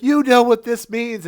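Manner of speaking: high, loud desperate plea; pleading. Retold or imitated. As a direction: plead with rising panic. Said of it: "Tuvix was begging for his life".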